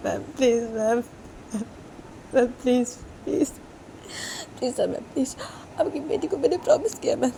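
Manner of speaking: high desperate pleading woman; tearful begging. Acted. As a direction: beg her not to stop the visits. Ruhi begging on the phone.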